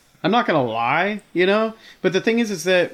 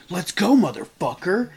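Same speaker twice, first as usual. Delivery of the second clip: goofy tough-guy challenge; 2 semitones higher than usual. Retold or imitated. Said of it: "the goofiest".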